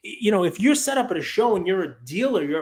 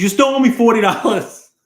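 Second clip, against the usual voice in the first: loud, demanding quote; mock demanding dealer, loud; 3 semitones higher, 10 dB louder than usual. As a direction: bark the demand at the kid. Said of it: "walks back over and says".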